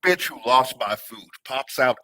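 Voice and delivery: usual speaking voice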